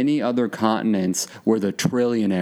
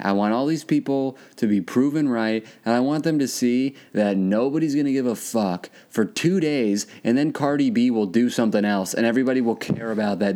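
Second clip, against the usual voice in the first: ordinary talk, nothing marked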